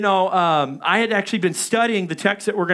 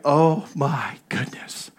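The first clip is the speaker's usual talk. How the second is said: slow, breathless whisper; hushed so nothing hears you; whispering in fear alone in the dark woods; scared whisper; 3 semitones lower, much slower than usual. Retold or imitated.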